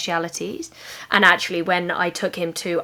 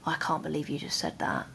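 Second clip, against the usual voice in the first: inner thought; 3 semitones lower, 10 dB quieter than usual. Retold or imitated. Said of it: "And I thought".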